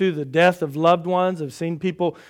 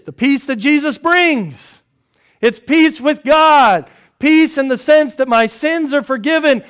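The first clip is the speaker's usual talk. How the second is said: full-force preaching; 9 semitones higher, 9 dB louder than usual. Acted. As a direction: proclaim peace to the congregation.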